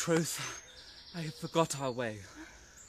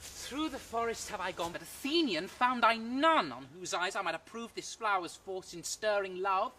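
sprite voice; 6 semitones higher than usual. Acted. Puck's voice, sprite speech.

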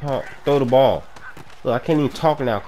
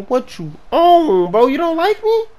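high, stretched-out protest; incredulous whine; 13 semitones higher, 5 dB louder than usual. Real, not acted. Gamer complaining to his quarterback, incredulous whine. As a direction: plead in hurt disbelief.

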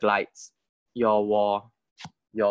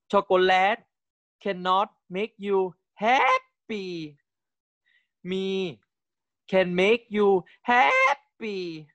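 higher, slow, cutesy sing-song pleading; cute Thai pop idol voice; 7 semitones higher, slower than usual. Pretend. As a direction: coo it like a playful idol teasing a fan. Thai singer clip read in character.

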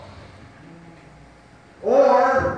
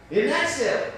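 ordinary delivery

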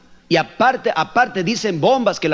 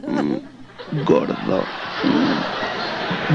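cooing wife; 12 semitones lower, much slower than usual. Retold or imitated. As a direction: purr at him to get something. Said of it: "empiezan así medio a rechinar".

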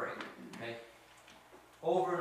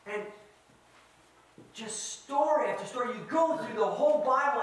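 loud, raised pitch, slowing for weight; impassioned preaching; 6 semitones higher, 7 dB louder than usual. Real, not acted. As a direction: hammer the point home to the congregation. Preaches at full force.

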